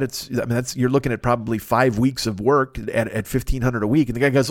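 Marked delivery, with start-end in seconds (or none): none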